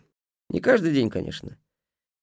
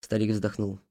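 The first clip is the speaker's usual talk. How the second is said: plain reading voice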